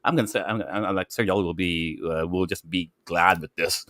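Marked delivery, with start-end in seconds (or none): none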